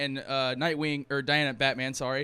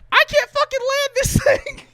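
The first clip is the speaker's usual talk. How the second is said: very high, loud mock panic; high-pitched comedic scream over the radio; panicked comms yell; 10 dB louder than usual. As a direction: yell it like a hammed-up pilot in trouble, breaking into laughter. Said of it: "you hear over the comms".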